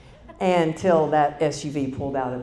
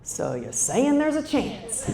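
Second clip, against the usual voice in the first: goofy hope; 7 semitones higher, slower than usual. Retold or imitated. Hopeful movie-quote joke.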